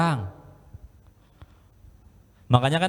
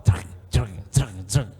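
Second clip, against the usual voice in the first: imitated jolts; 6 semitones lower, slower than usual. Bunyi pesawat naik turun.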